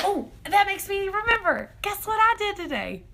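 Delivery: high, bright burst of sudden memory; excited gasp. Real, not acted. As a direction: gasp and jump up in pitch, bursting to tell. Suddenly remembers news to share.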